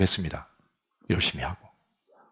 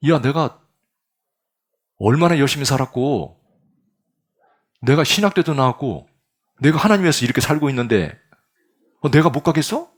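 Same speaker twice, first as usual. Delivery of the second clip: louder, higher, self-righteous pushback; preacher answering his conscience, offended and louder; indignant protest; 5 semitones higher, 4 dB louder than usual. Acted. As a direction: insist you have earned heaven. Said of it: "그렇게 생각하는데".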